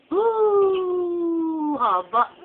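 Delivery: high loud delighted ooh; delighted exclamation. Real, not acted. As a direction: marvel out loud at it. Baby gives a long yawn.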